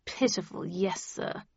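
stiff, subdued assent; stony. A narrator's reading. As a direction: agree without any enthusiasm. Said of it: "he replied stonily".

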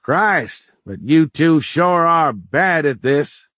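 high, slow drawl called across the shop; calling out. A narrator's reading. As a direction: call from the front, folksy and unimpressed. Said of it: "called from the front".

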